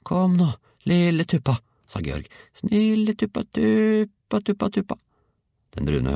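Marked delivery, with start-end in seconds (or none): 0.1-1.6 put-on high boy's coaxing voice; coaxing a hen. A narrator's reading. Georg urges hen toward the spoon, high coaxing. 2.5-4.9 very high, repeated bird call; coaxing a hen. A narrator's reading. Georg begs the hen to come, high coaxing.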